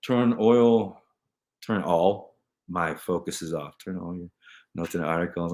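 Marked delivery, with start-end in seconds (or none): none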